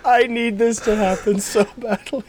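high, laughing eagerness; excited. Real, not acted. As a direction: gush it out, thrilled. Gleeful wish about Pruitt stunt.

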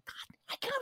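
voiceless, held-back exclamation; stifled whisper. Retold or imitated. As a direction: imitate a whispered backstage freak-out. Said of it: "they couldn't yell, they couldn't scream".

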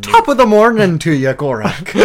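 very high, loud hammed-up Irish greeting; mock Irish accent. Player teases game master's slipped accent, mock Irish.

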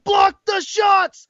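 high, loud, slow shout; bold shouted order. Retold or imitated. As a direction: bellow the order with all your force. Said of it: "the way it's all bolded".

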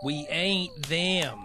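stubborn slow repeat; slow, drawn out. Acted. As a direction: drag it out stubbornly. Repeated catchphrase.

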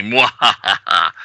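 slow hammed-up villain cackle; mock mwahaha, drawn out slowly; evil villain laugh. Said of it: "twirl his goatee".

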